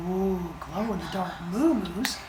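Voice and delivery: put-on soft, high toddler wonder; awestruck baby. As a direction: coo in hushed delight like a small child. Baby marvels at glowing cows.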